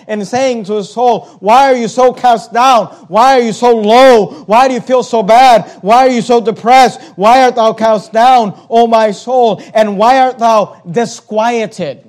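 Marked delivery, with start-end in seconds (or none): 1.4-12.0 loud, pressing rhetorical questions; preaching at full force. Acted. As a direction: hammer each why home. Preacher voices psalmist questioning his soul.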